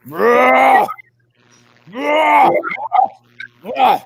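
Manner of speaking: shouted high rah calls; loud creature call. Retold or imitated. Describing a creature forty yards out, shouting its call. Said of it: "this thing comes up forty yards out and goes".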